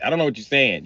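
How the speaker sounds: ordinary talk, nothing marked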